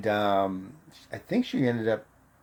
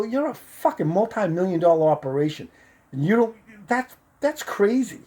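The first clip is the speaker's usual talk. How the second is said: retold indignation; 8 semitones higher than usual. Retold indignant argument with company.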